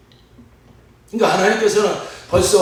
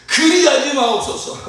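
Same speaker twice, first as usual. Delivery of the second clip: protesting Peter; 10 semitones higher than usual. Acted. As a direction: blurt out an alarmed objection, hammed up. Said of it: "예수께 대들었다". (대드는 베드로 흉내, 높게 외치듯)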